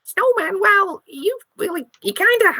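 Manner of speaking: high, quick mouse voice; a scared little mouse spilling rumors; squeaky mouse. Acted. Mouse sending the detective off, high and squeaky.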